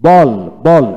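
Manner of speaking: বল loud and distinct, twice; model reading. Shown as a demonstration. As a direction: drill বল loudly with an open "aw". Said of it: "আমি এখন পড়ে শোনাই".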